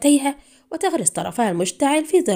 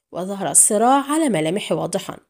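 plain reading voice

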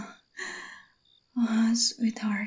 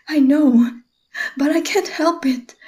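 sudden outburst; 5 semitones higher, 4 dB louder than usual. Acted. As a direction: voice jumps up, higher and louder. Outburst inside whispered scene.

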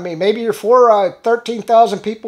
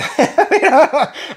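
sarcastic laugh; 5 semitones higher than usual. Real, not acted. Laughs at good dude irony.

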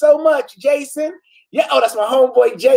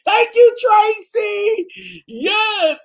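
excited squeal; 11 semitones higher, much slower than usual. Real, not acted. Squealing thanks to a viewer.

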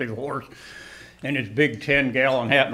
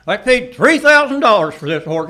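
loud, higher outraged protest; outraged cowboy; 7 semitones higher, 9 dB louder than usual. Retold or imitated. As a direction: sputter it out like a furious rich cowboy. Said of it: "or wherever it was he's from".

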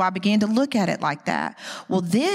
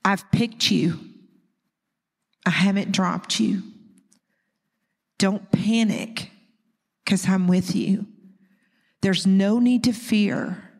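tender scripture reading. Acted. Reads scripture, holding back tears.

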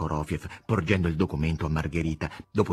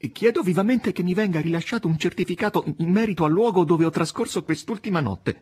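timid but insistent; 9 semitones higher, 3 dB louder than usual. A narrator's reading. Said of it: "timidamente ma con grande insistenza".

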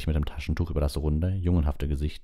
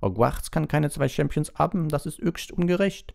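much higher pitched protest; indignant headmistress; 12 semitones higher than usual. A narrator's reading. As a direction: protest the injustice in a high voice. French headmistress protests at unfairness. (empörte Schulleiterin, sehr hohe Stimme)

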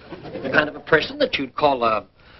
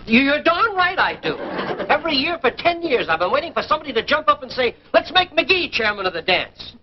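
higher, rising grievance; indignant outburst; 7 semitones higher than usual. Acted. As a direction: blurt it out, wounded. Husband bursts out about lodge.

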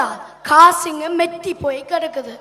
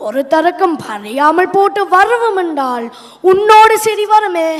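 loud, raised-voice scolding; quarrelsome; 4 dB louder than usual. Acted. Husband rounding on his muttering wife, loud and quarrelsome. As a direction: pick a fight, pushing the voice up.

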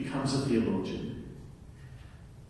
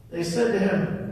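ordinary talk, nothing marked

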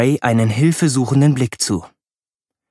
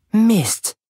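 annoyed oath; 8 semitones higher than usual. A narrator's reading. Superheroine stuck in frozen water.

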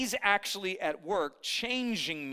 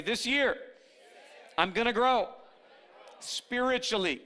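call-and-response; 5 semitones higher than usual. Shown as a demonstration. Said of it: "Look at someone next to you and say".

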